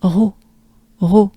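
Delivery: "ro" with breathed throat R, tongue down; breathy French R. Shown as a demonstration. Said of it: "just a breath".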